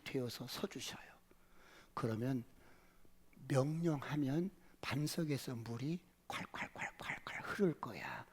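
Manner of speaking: hushed whisper. Real, not acted. Preacher whispering to the congregation. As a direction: breathe it out secretly.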